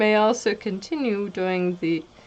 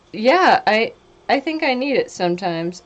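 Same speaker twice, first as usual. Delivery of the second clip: ordinary talk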